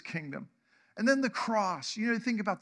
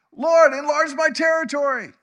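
much higher, shouted prayer; loud fervent plea; 7 semitones higher, 12 dB louder than usual. Acted. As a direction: cry out boldly to heaven. Jabez voiced praying to God.

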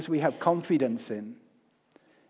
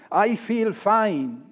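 drawn-out, higher-pitched mock cheer; putting on a front; 5 semitones higher, 4 dB louder, much slower than usual. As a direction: slowly insist all is well, a little too high. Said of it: "We put on a good front".

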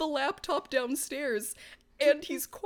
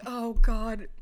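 a dreading groan of oh God; sinking realization, said louder; 5 semitones lower, 5 dB louder than usual. Retold or imitated. Realizing what mom did, muttered dread. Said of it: "And I'm like".

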